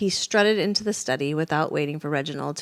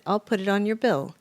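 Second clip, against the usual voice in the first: plain reading voice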